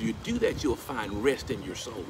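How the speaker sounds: usual speaking voice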